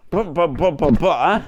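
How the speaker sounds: loud vocal "buh" noises; imitated noise. Shown as a demonstration. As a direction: punch out a loud run of buhs. Imitated noise after pressing button.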